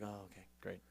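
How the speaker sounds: very soft, low, unimpressed reply; mock polite disinterest, barely voiced; underwhelmed audience. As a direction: imitate a bored crowd's limp approval. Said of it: "everyone's like".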